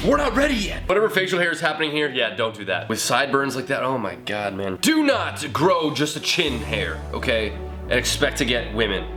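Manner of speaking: ordinary talk, nothing marked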